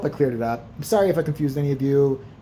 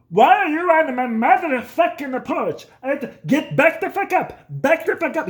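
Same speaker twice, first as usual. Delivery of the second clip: dad yelling; 11 semitones higher, 5 dB louder than usual. Retold or imitated. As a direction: roar him off the porch. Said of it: "My dad came flying out the door and said".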